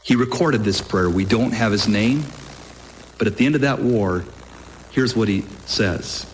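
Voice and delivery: ordinary talk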